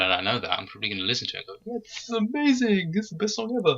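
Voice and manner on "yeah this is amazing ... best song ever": mock-ecstatic high-pitched raving; gushing excitement. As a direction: gush like a thrilled fan, pitched way up. Acts out own future gushing reaction.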